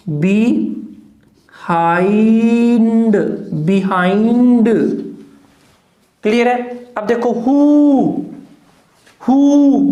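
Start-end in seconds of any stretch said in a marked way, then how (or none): 0.1-3.2 बिहाइंड stretched very slowly, syllable by syllable; slow demo word. Shown as a demonstration. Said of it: "तो क्या करोगे". 9.3-9.8 हु said loud and high, long ऊ; emphatic demo sound. Shown as a demonstration. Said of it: "बड़ा ये वाला हु".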